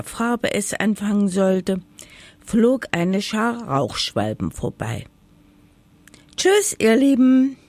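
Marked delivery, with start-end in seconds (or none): 6.4-7.6 high, loud farewell call to the birds; calling out goodbye. Acted. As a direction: call after the flying swallows. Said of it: "rief das Häckslein".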